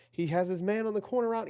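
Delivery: high, loud and fast as the receiver gets open; excited call. Real, not acted. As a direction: shout it as the pass goes up. Live call of a deep pass.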